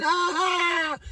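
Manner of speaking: high mock yell of riled inmates; wild scream. Inmates in the hole screaming, mock yell. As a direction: let out a crazy holler. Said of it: "Dudes screaming, yelling".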